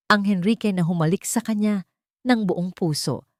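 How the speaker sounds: ordinary delivery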